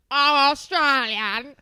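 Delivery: mocking Australian accent. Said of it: "scrunched your whole face up".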